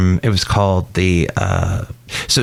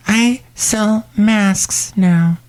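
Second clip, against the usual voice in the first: much higher, slow put-on lady voice; high character voice; 10 semitones higher, much slower than usual. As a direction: drag it out in the shopkeeper voice. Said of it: "It's Shelley Shuhart".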